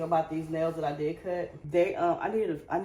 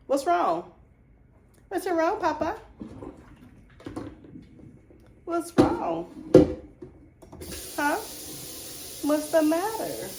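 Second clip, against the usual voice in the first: sweet high pet voice, asking what's wrong; 11 semitones higher, 5 dB louder, slower than usual. Real, not acted. Talking to her dog.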